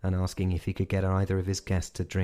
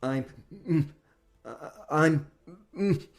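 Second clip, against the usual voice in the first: high, stuck, nervous stammer; can't get the words out; stammering in fear; 9 semitones higher, slower than usual. Acted. Frightened civilian facing the vampire, stammering. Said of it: "He couldn't form the words".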